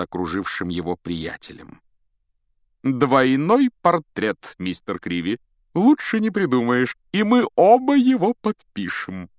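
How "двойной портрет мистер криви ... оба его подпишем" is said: bossy showman. A narrator's reading. Lockhart arranges a double portrait with Harry, grandly commanding. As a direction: direct the shot with grand relish. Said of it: "распоряжался Локонс".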